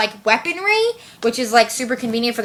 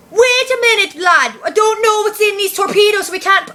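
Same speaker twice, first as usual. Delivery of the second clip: high, loud mock Scottish accent as Scotty; hammed-up Scottish brogue, pitched up; bad Scottish accent; 9 semitones higher, 3 dB louder than usual. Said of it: "pretend to have a Scottish accent".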